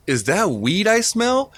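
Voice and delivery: mock cop question. Friend retells traffic cop asking about weed, mock suspicious. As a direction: play the cop sniffing out weed.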